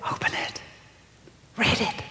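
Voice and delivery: imitated urging whisper; hushed inner voice. Inner voice urging a teenager to read, hushed whisper. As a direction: whisper it like a secret prompting. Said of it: "And the other voice said".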